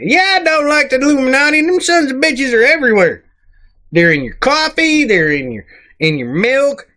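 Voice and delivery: high-pitched, worked-up outburst; indignant ranting retort. Acted. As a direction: fire back in high-pitched comic outrage. Indignant rant answering the comment.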